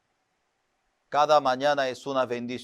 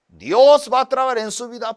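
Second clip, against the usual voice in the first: higher, louder declaring pastor; pastor's blessing; 7 semitones higher, 6 dB louder, faster than usual. Retold or imitated. Preacher imitating his pastor pushing him, proclaiming.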